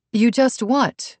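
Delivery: pointed, indignant question; demanding. A narrator's reading. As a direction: demand an answer, drawing it out. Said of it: "Brooke demanded".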